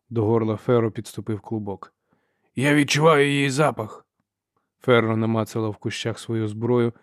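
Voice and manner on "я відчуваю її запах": raised, higher hunter's call. A narrator's reading. Brother scenting Ferro calls out to sister. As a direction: call it across to a partner.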